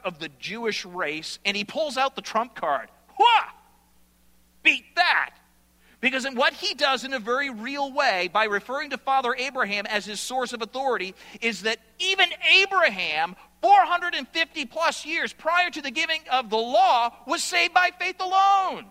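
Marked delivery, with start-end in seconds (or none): 3.1-5.3 loud, high gloating cry; mock triumphant yell; triumphant shout. Mimics Paul playing trump card. 11.4-18.9 higher and louder, building to a climax; preaching at full force; rising excitement. Real, not acted.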